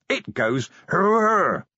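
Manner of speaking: slow, high animal roar; crazed creature. A narrator's reading. Vimes attacking guards with picture-book noises, crazed. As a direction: draw out the hurr as a high cry.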